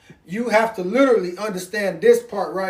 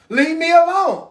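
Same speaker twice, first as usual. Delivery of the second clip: loud, higher, fed-up leave me alone; fed-up snap; 7 semitones higher, 4 dB louder than usual. Retold or imitated. Worn-out parent pushing kids away, loud and fed up. Tired parent wants rest.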